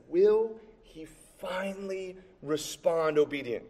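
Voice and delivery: softly dragged-out, suspenseful wondering; drawn-out suspense. Real, not acted. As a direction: stretch each word out as if still waiting. Drawing out the suspenseful question.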